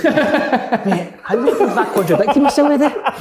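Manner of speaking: laughing through the words, pitch up; giggling at his own muddle; cracking up mid-sentence. Real, not acted.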